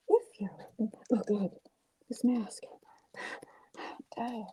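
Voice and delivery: soft, spent whisper; tired whisper. Real, not acted. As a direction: breathe it out exhausted. Worn out after mask work.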